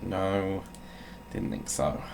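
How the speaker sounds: ordinary delivery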